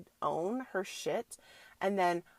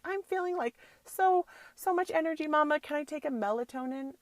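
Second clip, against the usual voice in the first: put-on high, bouncy kid voice, much higher; imitating excited daughter; 8 semitones higher, 5 dB louder than usual. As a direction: play the wired little girl begging Mama. Daughter quoted asking mom.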